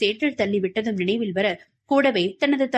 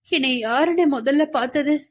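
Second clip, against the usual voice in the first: higher, pained question; suppressed anguish; 4 semitones higher than usual. A narrator's reading. Patient asks who found her first, hiding her anguish. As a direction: ask while holding the hurt in. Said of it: "உள்ளத்து வேதனையை உள்ளடக்கியபடி கேட்டாள்".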